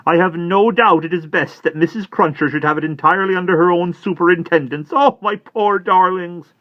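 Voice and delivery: high, loud, mildly weepy; tearful Miss Pross. Acted. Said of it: "striving to dry her eyes".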